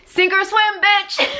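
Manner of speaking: sassy high tough-love taunt. Pretend. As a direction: fling it out like a blunt drill sergeant with attitude. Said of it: "God is like".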